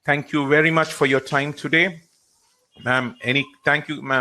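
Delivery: ordinary delivery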